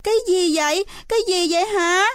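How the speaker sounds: put-on high, panicky child's question; alarmed. A narrator's reading. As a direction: ask in startled alarm, pitched high. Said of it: "mặt mày thất sắc". (hốt hoảng, giọng cao)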